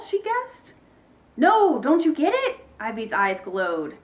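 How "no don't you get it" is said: high, loud, insistent question; urgent exasperation. A narrator's reading. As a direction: push it out impatiently, desperate to be understood. Ivy impatient with Bean's guess.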